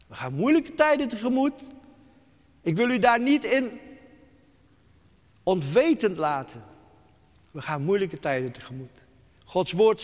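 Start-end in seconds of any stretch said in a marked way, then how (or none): 0.1-6.6 urgent warning. Acted. Repeated warning, first take.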